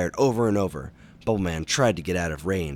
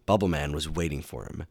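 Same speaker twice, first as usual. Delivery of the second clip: ordinary delivery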